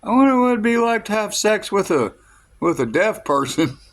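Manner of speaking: high-pitched put-on girlfriend voice; imitated girlfriend. Girlfriend idly wondering aloud about deaf people, high imitated voice. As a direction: start very high, easing down a little, imitating her musing. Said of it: "She's like".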